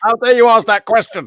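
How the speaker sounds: loud, high mock outrage; playfully scandalised shout; mock angry shouting. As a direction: pretend to yell indignantly at the screen. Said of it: "you're shouting at this screen".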